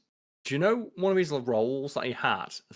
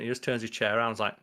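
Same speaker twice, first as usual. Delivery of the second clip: ordinary talk, nothing marked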